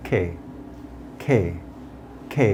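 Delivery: "কে" with a puffed K, like খে; aspirated K drill. Shown as a demonstration. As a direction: say "কে" singly, air bursting from the K. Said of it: "So, it sounds like". ("কে"-র ক হাওয়া ছেড়ে খে-র মতো)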